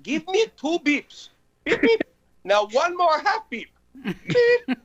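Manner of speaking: very high, slow mystic with loud beeps; Haji voice. Retold or imitated. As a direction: play the know-it-all psychic. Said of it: "Basil would break into Haji".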